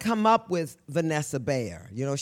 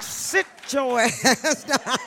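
church mother imitation; 8 semitones higher, much slower than usual. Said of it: "In church".

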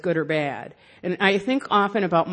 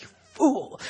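mocking; 6 semitones higher than usual. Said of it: "I was just mocking him".

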